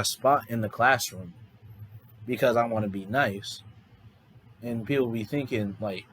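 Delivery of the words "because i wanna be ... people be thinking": ordinary talk, nothing marked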